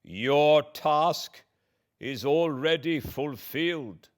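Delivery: high, loud, bitter cry; exclaimed. A narrator's reading. Victor exclaims to the monster in despair, loud and high. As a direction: cry out that all is lost. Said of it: "I exclaimed".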